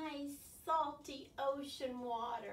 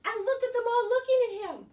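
higher, louder, full of wonder; excited wonder; 10 semitones higher, 12 dB louder than usual. Real, not acted. Teacher excited by scene.

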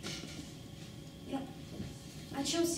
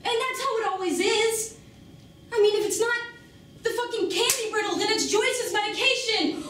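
raised, higher-pitched bitter tirade; angry outburst; 5 semitones higher, 3 dB louder than usual. Acted. Woman confronting Mikey about having no choices, angry outburst. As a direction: let frustration boil over, loud and high.

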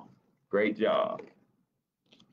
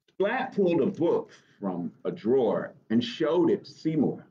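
ordinary delivery, nothing marked

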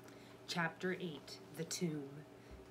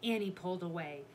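usual speaking voice

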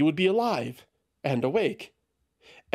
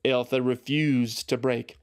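plain reading voice

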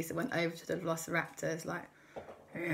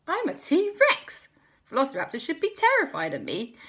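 triumphant; 11 semitones higher, 7 dB louder than usual. Acted. Christopher realises he's a T-Rex.

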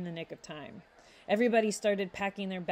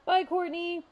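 high, loud call while running off; shouted goodbye; 11 semitones higher, 4 dB louder than usual. A narrator's reading. Boys running to the club, shouting goodbye high and loud. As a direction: yell it over the shoulder. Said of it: "the two boys screamed out".

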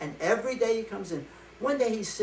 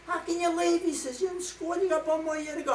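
higher, incredulous friend voice; pleading friend; 8 semitones higher than usual. Acted. Student pleads with his friend not to quit school. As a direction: plead with him, pitched up in disbelief.

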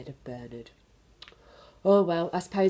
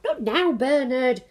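higher, louder, slow dismissive mother; busy mum; 6 semitones higher, 7 dB louder than usual. Acted. Mum brushes off Bernard, too busy to listen. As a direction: wave him away without looking.

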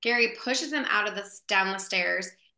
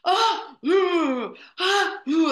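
high, loud mock moans and gasps; exaggerated oohs and ahs; imitated suggestive cries; 8 semitones higher, 5 dB louder, much slower than usual. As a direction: imitate alarming noises heard through a wall. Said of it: "It sounds really bad outside".

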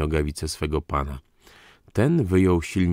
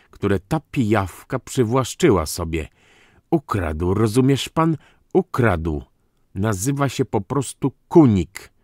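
higher-pitched, emphatic aristocrat's tirade; irate count; 5 semitones higher, 5 dB louder than usual. Acted. Count exposes his brother-in-law's false name, scornfully indignant.

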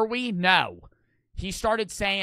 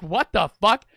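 louder, baffled outburst; incredulous; 3 semitones higher, 8 dB louder, slower than usual. Retold or imitated. Streamer retelling his reaction to his tripping friend, incredulous. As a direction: blurt it out in baffled disbelief.

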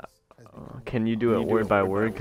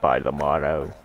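put-on British voice, pitched down; British accent; 6 semitones lower, 4 dB louder than usual. Player performing the given sentence, put-on British accent. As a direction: recite it in a mock British accent. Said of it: "do it in a British accent".

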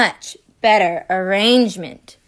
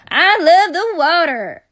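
high excited voice; 7 semitones higher, 5 dB louder than usual. Acted. Nebraska's voice.

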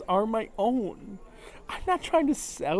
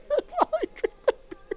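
crying laugh. Real, not acted. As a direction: laugh and sob at once, pitch cracking up high. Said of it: "I'm crying".

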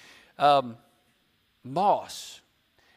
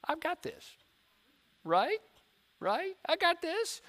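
lightly acted cockiness, high-pitched and softer; prideful self-assurance; 12 semitones higher, 5 dB quieter than usual. Lost person brushing off any help, mock-confident. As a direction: breezily insist you need no help. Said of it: "We have so much pride".

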